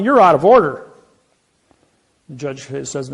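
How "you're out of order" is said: loud, high objection; mock courtroom outburst; retold shout.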